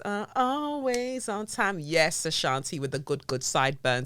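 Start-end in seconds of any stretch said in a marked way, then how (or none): 0.0-1.8 high, sing-song self-defence; lightly playing a breezy professional; mock sing-song. Excusing herself to clients in a high, breezy voice.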